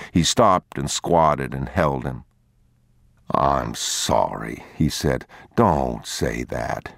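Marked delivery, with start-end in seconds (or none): 3.3-4.6 a hint of a tired father comforting; tender apology. Father holding his crying son, low and tender. 5.5-6.9 a hint of a tired father comforting; tender apology. Father holding his crying son, low and tender.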